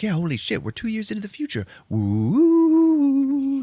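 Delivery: excited rising cheer ending in a shout; thrilled teen. Retold or imitated. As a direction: celebrate time travel with a woo. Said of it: "So he's like".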